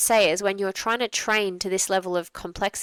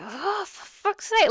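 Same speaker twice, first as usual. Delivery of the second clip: exasperated curse; 12 semitones higher, slower than usual. Retold or imitated. Exhausted person drops their dinner.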